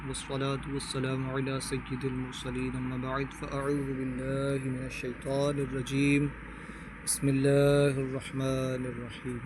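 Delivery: slow, measured Arabic recitation; formal Quranic-style invocation; solemn opening recitation. Acted. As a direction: intone the opening blessing devoutly.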